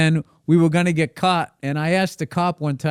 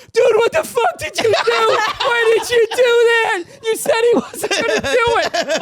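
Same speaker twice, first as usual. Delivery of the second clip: 1 mock panicked friend. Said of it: "He's like".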